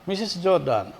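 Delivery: ordinary talk, nothing marked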